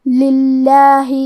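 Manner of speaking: للہ with a light, thin lam, drawn out very slowly. Shown as a demonstration. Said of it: "لام کو پتلی آواز میں پڑھنا ہے". (للہ کا لام پتلی آواز میں، بہت آہستہ)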